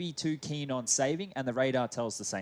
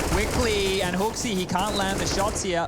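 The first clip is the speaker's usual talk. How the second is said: loud, higher hype shout; 7 semitones higher, 7 dB louder, slower than usual. Real, not acted. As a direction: yell the action over the crowd. Caster yells during action.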